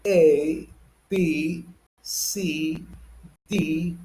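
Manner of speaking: British letter-by-letter alphabet; British pronunciation drill, evenly spaced; British accent. Shown as a demonstration. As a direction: recite each letter carefully in British English. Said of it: "with the same but the British one".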